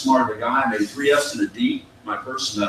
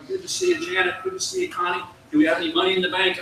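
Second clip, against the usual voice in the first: breezy greeting; 6 semitones higher, 3 dB quieter, faster than usual. Retold or imitated. Boss greeting staff on return.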